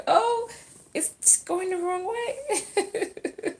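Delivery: high, squeaky mock alarm breaking into laughter; playful mock dismay. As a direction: squeal it slowly in pretend dismay, giggling at the end. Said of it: "so that I go".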